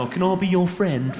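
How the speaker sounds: timid cockney plea; chirpy eager-to-please Londoner; Danny Dyer. Retold or imitated. Comedian imitates Danny Dyer meeting a hard man, timid. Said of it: "Danny Dyer's going".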